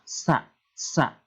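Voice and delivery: "sa" with tongue tip against upper teeth; short, separate "sa", tongue touching the top teeth. Shown as a demonstration. Teacher drilling the letter, tongue-tip "sa". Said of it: "dirapatkan dengan gigi atas".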